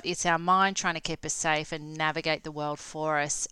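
ordinary talk, nothing marked

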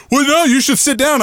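high-pitched mimicked objection; mocking the women. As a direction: imitate their protest, pitched well up. Mimicking the women arguing back.

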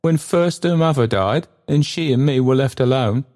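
put-on high, pleading old man; an anxious old porter speaking. Old Trotty speaking, far higher.

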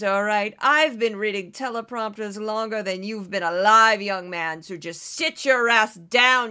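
loud, brash, bossy newscaster-turned-politician voice; Kari Lake impression. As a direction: boss the boys around like a haughty ex-anchor. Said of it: "this is my impression of Kari Lake".

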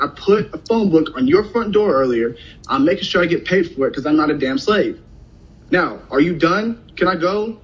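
fed-up, defiant retold retort; mild mock defiance at the trucker; with attitude. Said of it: "and I got an attitude with him".

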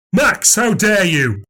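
slow, high cry of outrage; outraged cry. A narrator's reading. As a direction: scold him as an appalled dad. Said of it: "cried Dad".

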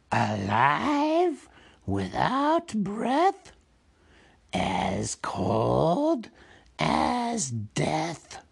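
slow, drawn-out creature voice, swooping in pitch; Gollum posing a riddle. Acted. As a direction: stretch out each riddle phrase eerily. Gollum asks his riddle.

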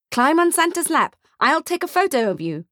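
high, eager selling voice; pushy salesman. Acted. Photographer to the boy, high and pushy. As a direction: coax the kid onto the lap.

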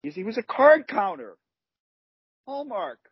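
high, triumphant reveal; gleeful punchline reveal. Real, not acted. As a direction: reveal the pun with glee.

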